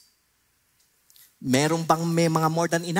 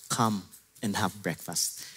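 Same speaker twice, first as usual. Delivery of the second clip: softer, lower, inviting; gentle invitation; 7 semitones lower, 6 dB quieter than usual. Acted. Jesus inviting the disciples to breakfast, soft and low. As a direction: call them in kindly.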